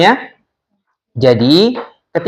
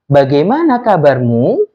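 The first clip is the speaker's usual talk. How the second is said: "Bagaimana kabarmu" lifted at the end; questioning rise. Shown as a demonstration. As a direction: let "kabarmu" climb like a question. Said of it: "ujungnya itu ada nada naiknya". (nada naik di ujung "kabarmu")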